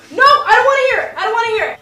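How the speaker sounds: loud, fast, annoyed protest; annoyed shouting. Real, not acted. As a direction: shout it down, irritated. Siblings arguing loudly.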